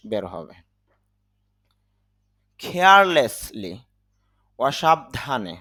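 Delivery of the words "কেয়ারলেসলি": কেয়ারলেসলি slow, with a strong breathy kh and stress on the first syllable; কেয়ারলেসলি said slowly as khearlessli; aspirated carelessly. Shown as a demonstration. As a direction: blow air on the kh of কেয়ারলেসলি. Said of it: "aspiration হবে".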